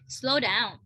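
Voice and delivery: ordinary delivery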